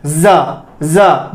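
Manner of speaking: जा said as a buzzing "za"; demonstrated Z sound. Shown as a demonstration. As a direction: model जा twice as ज़ा, air passing with vibration. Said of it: "अंदर से हम air pass करेंगे". (जा को ज़ा बोलें, हवा के कंपन से)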